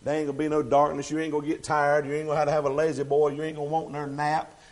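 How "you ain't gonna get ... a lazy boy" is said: ordinary talk, nothing marked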